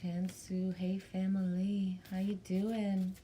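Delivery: usual speaking voice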